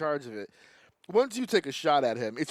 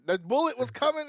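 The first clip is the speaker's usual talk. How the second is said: ordinary delivery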